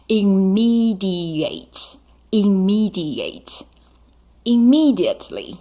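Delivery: slow whispered Immediate, immediate, immediately with stress on mi. Shown as a demonstration. 老师放慢轻声示范 immediately，重音在 mi. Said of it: "重音是咪".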